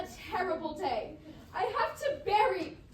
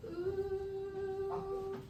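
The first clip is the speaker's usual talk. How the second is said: soft keening; 3 semitones higher than usual. Acted. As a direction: hum a quiet wail. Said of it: "you could do it softer than I did".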